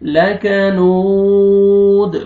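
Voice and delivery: لکنود very slow, final دال bounced with an echo; slow chanted لکنود with a bounced final دال; qalqalah on dal. Shown as a demonstration. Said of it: "قلقلہ کرنا ہے".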